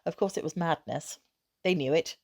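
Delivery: plain reading voice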